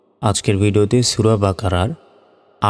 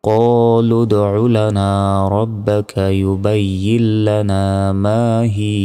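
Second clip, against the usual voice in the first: Quran recitation; much slower than usual. Acted. Teacher recites the verse before spelling it out, slow melodic chant. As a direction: draw every word out in measured recitation.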